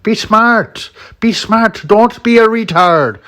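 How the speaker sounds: loud, high mock Irish accent; mocking impression in an Irish accent. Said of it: "he says it in his Irish accent".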